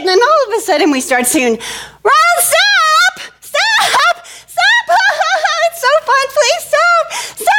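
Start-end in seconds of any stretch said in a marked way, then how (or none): 2.0-7.6 very high mock screams, words stretched; hammed-up kid panic; panicked squealing. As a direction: plead through shrieks and giggles on a runaway ride. Dizzy girls screaming to stop.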